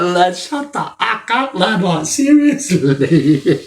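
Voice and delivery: high, slow, laughing shut-up; laughing retort. Retold or imitated. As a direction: drag it out high through laughter. Laughing reply to inmates.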